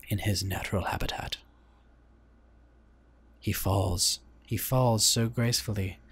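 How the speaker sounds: lightly attempted nature documentary narrator; measured, reverent wildlife narration with a shaky grasp of British; David Attenborough. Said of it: "get my David Attenborough voice on".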